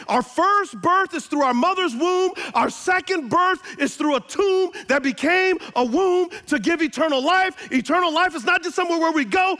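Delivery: loud, high preaching climax; shouting sermon peak; full-force preaching. Acted. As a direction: preach it out with power.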